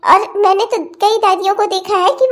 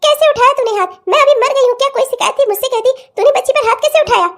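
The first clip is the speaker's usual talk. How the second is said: higher-pitched indignant telling-off; angry scolding; 4 semitones higher than usual. Acted. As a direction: confront her, demanding an answer. Grandmother confronts aunt for hitting child.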